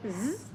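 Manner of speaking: brief zzz cut short; short buzz. Shown as a demonstration. Learner contrasting undragged words with a short buzz. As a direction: make a quick, short zzz. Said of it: "Instead of".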